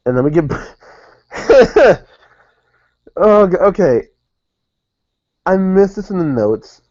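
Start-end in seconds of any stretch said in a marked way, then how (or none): 1.3-2.0 high, loud laugh; burst of laughter. Real, not acted. Host finding a gap in the recap notes, bursting out laughing. 3.2-4.1 high, loud flustered reaction; flustered amusement. Real, not acted. Host caught out by own notes, flustered and high. 5.5-6.8 high, slow, drawn-out admission; dismayed realisation. Real, not acted. Host caught out by own notes, high drawn-out dismay.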